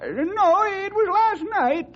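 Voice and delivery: usual speaking voice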